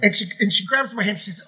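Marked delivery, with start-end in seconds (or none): none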